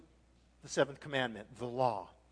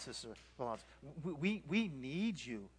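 ordinary talk, nothing marked

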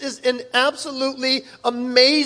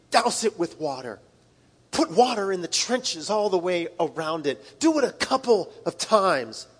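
low hushed command; 7 semitones lower, 6 dB quieter than usual. Retold or imitated. Elijah's commands, low quiet.

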